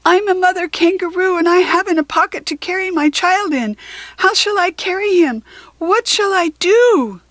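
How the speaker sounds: high and loud, calling up a tree; a worried mother shouting up to a deaf owl; mother kangaroo calling up. A narrator's reading. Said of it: "screamed at him".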